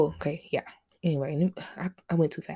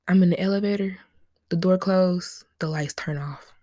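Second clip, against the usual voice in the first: normal talking voice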